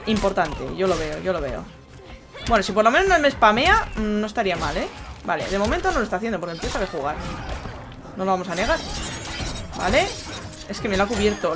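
ordinary delivery

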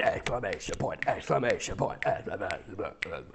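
low, soft monotone chant; deadpan recital. Pretend. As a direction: drone it like a machine. Reads punctuation aloud as running joke.

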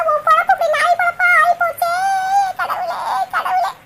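wailing lament. Acted. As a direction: cry out to god in despair. Mother lamenting daughter's fate.

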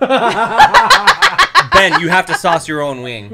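loud, high laughing shout of his name; laughing scold. Real, not acted. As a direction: yell at him mid-laugh.

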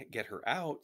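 earnest plea from a worried daughter; pleading. A narrator's reading. Girl pleads with resistance men for help.

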